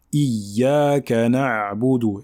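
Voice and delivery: na'budu with the throat Ayn sounded fully, very slow; clear Ayn. Shown as a demonstration. Teacher shows the right Ayn in na'budu, slow. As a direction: take time over the Ayn in na'budu. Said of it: "prendre le temps de bien la prononcer".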